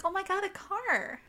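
high-pitched mock excitement over a gift; mimicked delight. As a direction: imitate a girl thrilled by her present. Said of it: "she's just so happy".

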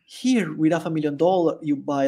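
ordinary talk

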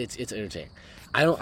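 ordinary talk

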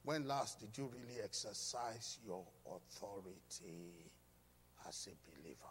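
dropping to a near whisper; hushed question. Acted. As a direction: lean in and ask it softly. Pointed question to congregation.

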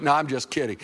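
ordinary talk, nothing marked

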